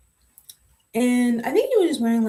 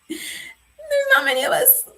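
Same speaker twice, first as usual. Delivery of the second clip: laughing; slower than usual. Real, not acted. Laughing remark on representation.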